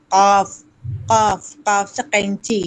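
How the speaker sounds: قاف drawn out slowly from the throat; throat q sound. Shown as a demonstration. Aunt drilling the throaty qaf sound, slow and emphatic. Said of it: "حلق سے قاف کی آواز نکالو".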